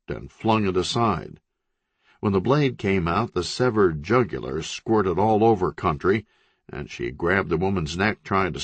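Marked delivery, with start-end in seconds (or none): none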